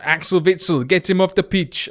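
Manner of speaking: high, forceful mock-Russian accent. Gamer heckling a Belgian player, in a stray Russian accent. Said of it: "I don't know why I'm going Russian".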